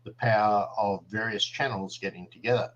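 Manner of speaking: quick, soft mock promo read. Host plugging the channel network, a quick mock promo. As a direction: rattle off a quiet promo. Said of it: "gonna do a little promo here".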